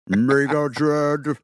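slow giant-robot drone; low robotic voice. Pretend. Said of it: "in a low robotic voice".

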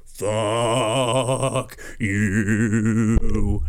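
low, drawn-out blaring "Fuck you"; rude horn. Pretend. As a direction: blast it like an angry horn. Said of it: "it just goes".

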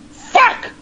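retold high, loud curse of frustration; high-pitched burst of disappointment; disappointed outburst. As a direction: mimic a rejected man's frustrated yelp. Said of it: "the guy was all disappointed when he went".

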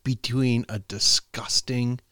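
slow, spaced-out riddle delivery; deliberate joke setup. Real, not acted. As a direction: draw out the setup with long pauses. Setting up his dad joke.

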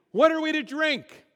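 high, loud mock complaint; acted-out outcry, pitched well up; grumbling complaint. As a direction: demand it like an indignant grumbler. Voicing grumbling Israelites' quote.

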